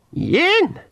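sharp, high pixie shout; high ritual cry. Acted. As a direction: cry the word out like a spell. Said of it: "said the old pixie".

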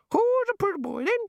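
very high squawking bird; parrot voice. A narrator's reading. Birds in bushes taunt the man, parrot squawk.

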